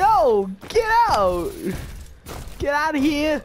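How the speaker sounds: high, loud shout to drive someone off; high-pitched alarmed shouting; panicked yell. Real, not acted. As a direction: yell frantically at an attacker. Yelling at attacker in game.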